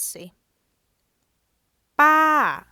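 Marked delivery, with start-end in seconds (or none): 2.0-2.6 "Ba" very loud, starting high and falling sharply; Thai falling tone. Shown as a demonstration. Teacher modelling the second tone mark on "Ba", loud falling tone. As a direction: shout "Ba" high and let it drop. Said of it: "so that's falling".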